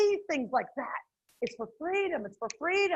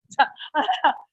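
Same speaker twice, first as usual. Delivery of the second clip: loud joyful laugh; 8 dB louder than usual. Real, not acted.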